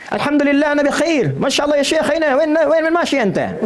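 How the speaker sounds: high-pitched, animated Arabic impression; hammed-up Arabic accent, pitched right up.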